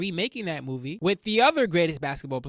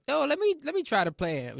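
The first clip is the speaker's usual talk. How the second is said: grandma's request; 8 semitones higher than usual. Retold or imitated. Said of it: "my grandmother was like".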